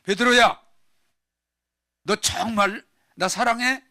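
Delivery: insistent loud plea. Acted.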